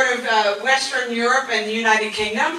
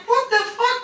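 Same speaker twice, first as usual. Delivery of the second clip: indignant retort; 6 semitones higher than usual. Retold or imitated. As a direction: fire back in mock outrage. Acted quarrel with Dutch woman.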